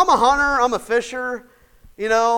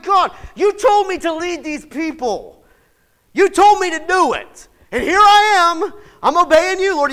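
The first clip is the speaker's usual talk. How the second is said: high, loud, exasperated plea to God; frustrated Moses; 10 semitones higher, 8 dB louder than usual. Retold or imitated. Moses complains to God about leading, loud and exasperated. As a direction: vent at God like a fed-up leader. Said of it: "and he's like".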